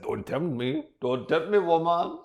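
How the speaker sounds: badly faked German threat; bad German accent. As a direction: attempt a German accent, rising in pitch and force. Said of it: "I can't do German".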